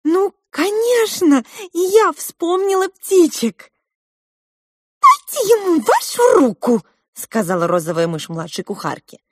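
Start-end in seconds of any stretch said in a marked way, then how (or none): 0.0-3.7 put-on high, loud girl's exclamation; fluttering excitement. A narrator's reading. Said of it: "Маринка тоже затрепетала". 5.0-6.8 high, loud squeaky little mouse voice; pink mouse. A narrator's reading. Розовая мышь говорит.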